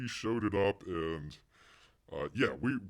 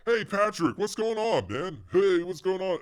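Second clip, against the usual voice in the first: eager greeting; 8 semitones higher, 5 dB louder than usual. Retold or imitated. Greeting Patrick at convention.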